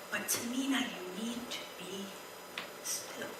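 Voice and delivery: God gently telling Tamina to be still; hushed. Acted. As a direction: whisper the call to stillness. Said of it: "speaking as God to her".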